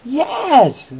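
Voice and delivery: slow, high, loud bedtime-story reading; hammed-up children's Bible story voice; dramatic storytelling. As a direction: read it like a big dramatic bedtime tale.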